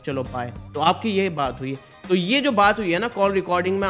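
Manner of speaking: ordinary delivery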